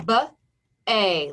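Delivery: "B-A" as separate sounds, buh then long ay; sounded out. Shown as a demonstration. Teacher modelling spelling by sound, "B-A" segmented into buh, ay. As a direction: say the two sounds of "B-A" apart. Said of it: "First syllable".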